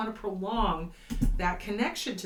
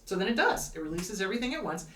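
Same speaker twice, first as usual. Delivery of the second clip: ordinary talk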